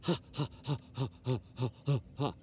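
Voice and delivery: even, soft, put-on ha-ha-ha; steady deliberate fake laughter; demonstrated laughing exercise. Shown as a demonstration. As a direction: pump out low laugh syllables to work the lungs. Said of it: "Exercise those lungs".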